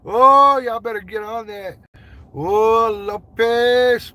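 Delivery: high, drawn-out excited holler; hollered greeting. Real, not acted. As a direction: call out loud and long to a friend arriving. Hollered greeting to a viewer joining.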